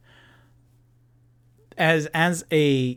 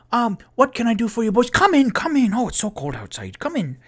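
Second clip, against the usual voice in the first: very high, loud delighted halfling host; cheery halfling; 10 semitones higher, 5 dB louder, faster than usual. Acted. Mister Peabody greeting returning guests at his door, very high and delighted. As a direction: squeal a bright welcome up high.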